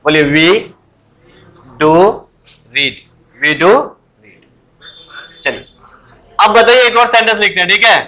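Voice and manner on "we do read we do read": "We do read" said slowly, word by word, leaning on "do"; teacher demo, "do" pressed; emphatic do. Shown as a demonstration. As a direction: model the stress on "do" for the student. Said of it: "ऐसे बोलिए".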